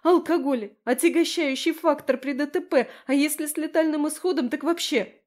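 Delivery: high-pitched agitated wailing; panicked lament. Acted. Friend keeps panicking aloud.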